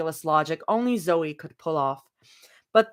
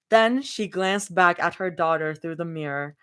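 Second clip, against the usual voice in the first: ordinary delivery, nothing marked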